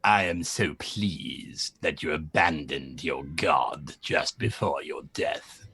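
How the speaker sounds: very low, soft, slow menace; deep, hushed otherworldly villain; voice of oblivion. Acted. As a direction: speak as a dark god claiming a soul. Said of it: "and you hear a voice".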